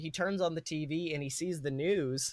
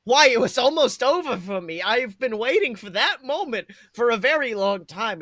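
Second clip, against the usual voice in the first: higher and louder, anguished protest; distraught why why; upset Stan; 6 semitones higher, 10 dB louder than usual. Acted. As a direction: cry out that it should have ended. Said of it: "Stan kinda seems upset".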